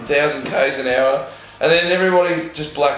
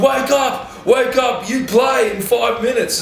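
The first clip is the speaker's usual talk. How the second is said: much higher, shouted wake-up call; mock urgent shout; 9 semitones higher, much slower than usual. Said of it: "Geraldo's got me by the chest going".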